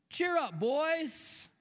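high, ringing call; hearty calling out. Acted. Jesus to a boat full of screaming fishermen, hearty call. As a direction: call it across the water to frightened friends.

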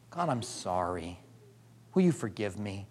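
very soft, low repentant prayer; humble prayer. Acted. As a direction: pray it almost under your breath.

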